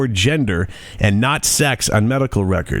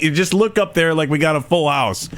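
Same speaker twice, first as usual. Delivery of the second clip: ordinary talk, nothing marked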